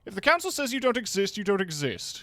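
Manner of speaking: high and smug, loud at the end; smug newsman. Acted. Newsman dodging blame, smug and loud. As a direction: shrug it off, then crow.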